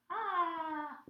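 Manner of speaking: high pretend "ah" of fright; small high cry of pretend fear; mock scared cry. Teacher adding to the scared face, mock frightened cry. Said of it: "like, a scared person's face".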